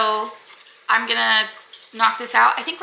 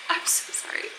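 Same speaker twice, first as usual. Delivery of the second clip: whispered apology; 8 dB quieter than usual. Real, not acted. Apologizing while teary on camera.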